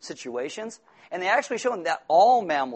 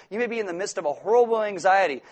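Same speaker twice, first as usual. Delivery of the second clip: ordinary talk, nothing marked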